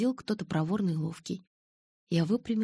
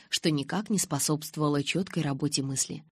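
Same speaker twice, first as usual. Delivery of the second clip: ordinary delivery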